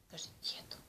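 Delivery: whispered prompt; hushed coaching whisper. Real, not acted.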